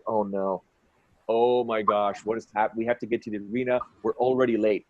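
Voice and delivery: low, soft groaning dread; quiet dismay. Retold or imitated. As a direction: sink into a low, defeated "oh no". Reacting to police pulling them over.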